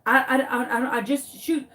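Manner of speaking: repeated false starts on "I"; flustered stutter. Retold or imitated. Neighbor pressed about missing chicken, stuttering helplessly. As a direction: trip over the first word, flustered. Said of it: "Now the poor man is stuttering".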